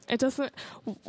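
ordinary delivery

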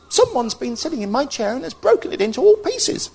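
very high little bear squeak; small squeaky baby voice. Acted. Little Bear finds the broken chair, squeaky baby voice. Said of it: "in a small, squeaky baby voice".

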